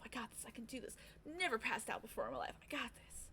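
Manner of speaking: hushed, almost whispered pep talk; self pep talk. Retold or imitated. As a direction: psych yourself up under your breath. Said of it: "Like, pep talking myself".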